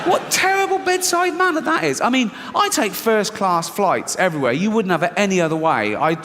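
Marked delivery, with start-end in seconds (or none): 0.1-2.0 high, incredulous comic complaint; appalled squeak of indignation; mock outrage. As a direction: protest in a pitched-up voice. Mock outrage at hostess's manner.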